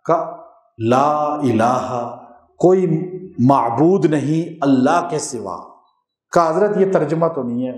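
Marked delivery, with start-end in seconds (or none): 0.9-2.2 لا الہ stretched out long; solemn drawn chant; very slow recitation. Shown as a demonstration. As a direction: draw out each syllable. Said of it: "کہا جی لا".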